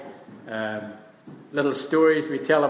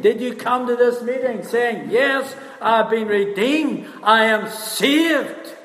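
high, slow, stretched-out declaration; fervent preaching; 7 semitones higher, slower than usual. Acted. Preacher challenging the meeting to testify, fervent. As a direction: build to a ringing testimony.

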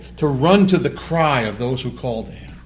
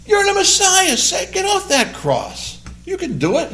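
mocking criminal. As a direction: taunt him up high to prove it. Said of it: "Imagine the guy next to him".

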